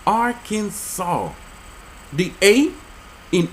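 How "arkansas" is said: Arkansas said slowly as Ar-kan-saw; silent final S. Shown as a demonstration. Explaining the law's pronunciation, Arkansas slowly with no final S. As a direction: draw out Arkansas, ending on an open saw. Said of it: "The final S silent".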